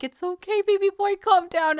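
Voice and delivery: high, gushing mock comfort; cooing reassurance. Fan soothing her flustered favorite character, cooing. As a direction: coo it like to a flustered baby.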